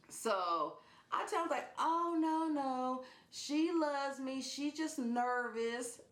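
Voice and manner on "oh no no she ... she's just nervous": high, slow doting voice; cooing reassurance, retold. Owner reassuring scared John about her cat, high cooing. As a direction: coo it high, dragging the words out.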